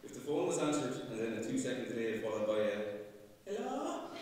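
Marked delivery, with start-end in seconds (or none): 3.4-4.1 a much higher, sharp Kerry "Hello?" imitating mother; Kerry accent. Son imitating his Kerry mother answering the phone, high Kerry accent. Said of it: "in a Kerry accent".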